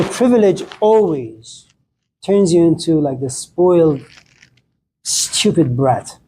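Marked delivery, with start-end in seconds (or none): none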